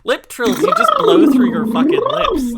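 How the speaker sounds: loud, high exasperated outburst; exasperated yell. Real, not acted. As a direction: raise your voice in mock outrage. Exasperated rant about lip trills.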